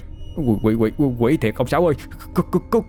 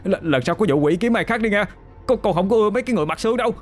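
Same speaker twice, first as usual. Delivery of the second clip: much higher, very fast frantic cry; panicked yelling while running; 11 semitones higher, much faster than usual. Acted. Boy yells while running into the shrine. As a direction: shriek as you flee. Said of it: "Vừa chạy vừa la".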